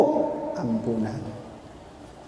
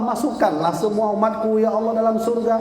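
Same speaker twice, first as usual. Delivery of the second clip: normal talking voice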